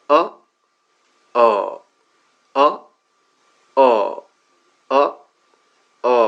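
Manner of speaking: আ in turn brief and stretched; short-long drill. Shown as a demonstration. Teacher asks learners to try along, short then long আ. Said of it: "আমার সাথে সবাই একটু try করেন".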